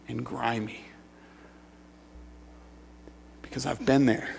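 very soft, low, long pauses between phrases; sober, halting near-murmur; grave confession. Real, not acted. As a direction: let it drop to a hushed, heavy admission. Confessing disgust after loud parody.

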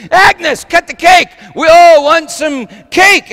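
high, loud holler across the room; impatient yell. Retold or imitated. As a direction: imitate a diner man urging her on. Harry impatient at weeping Agnes.

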